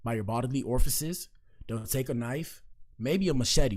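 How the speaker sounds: usual speaking voice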